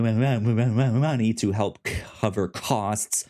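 ordinary talk

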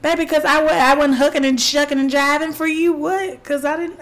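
loud, high, sassy pushback; indignant retort. Retold or imitated. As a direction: fire back as if insulted. Indignant retort about being called irritated.

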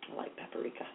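breathy whisper. Real, not acted. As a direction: whisper it to yourself. Whispered aside after snort.

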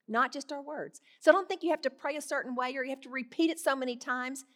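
ordinary delivery

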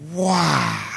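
drawn-out wow of amazement; awestruck, stretched-out exclamation; astonished. Acted. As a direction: let out one long, stunned wow. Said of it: "they were astonished".

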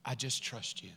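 soft, low prayer; quiet trust. Acted. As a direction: say it hushed, surrendering to God. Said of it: "and this is my words".

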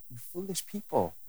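gentle tender version. Shown as a demonstration. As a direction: say it fondly, like to a dear friend. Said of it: "it's a big difference".

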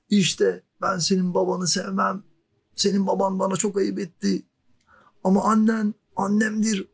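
high, shouted over the motorbike; shouting over the engine. Retold or imitated. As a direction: yell it over a roaring engine. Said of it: "Adam bağıra bağıra konuşuyor". (motorun sesini bastırmak için bağırarak)

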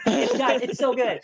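high, loud raving to the guys; excited fan gushing; giddy gushing. Real, not acted. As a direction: still buzzing with excitement. Continues the raving.

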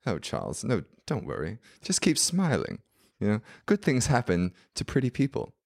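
put-on airy British accent, swooping in pitch; preening British socialite voice; vain British woman. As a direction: imitate a vain British lady giving advice. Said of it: "this was, like, the vain one".

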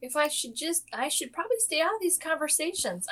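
very high, tentative little voice; hammed-up uncertain squeak; mock hesitance. Acting out an unsure, awkward reply.

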